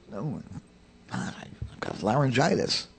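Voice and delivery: unvoiced, strained whisper; hoarse whisper. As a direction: whisper like your throat is gone. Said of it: "I did have a hoarse throat".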